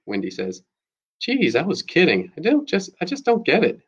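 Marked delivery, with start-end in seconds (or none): 1.2-3.8 defensive scoff. A narrator's reading. Maddie defensive, mocking.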